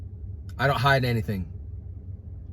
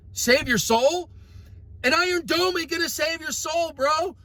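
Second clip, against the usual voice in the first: indignant questioning. Real, not acted. Preacher mocks the golden domes with rising questions. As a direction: ride the pitch up on each to save what. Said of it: "rising rhetorical questions".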